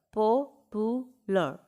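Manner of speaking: slow "Popular", a clear gap after each syllable; split into syllables. Shown as a demonstration. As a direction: sound out "Popular" beat by beat for a class. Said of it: "Break the word".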